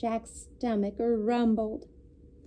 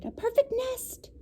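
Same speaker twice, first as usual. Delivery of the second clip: much higher hen voice; delighted chicken; 13 semitones higher, 3 dB quieter than usual. A narrator's reading. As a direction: squeal it like a thrilled hen. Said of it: "she cried".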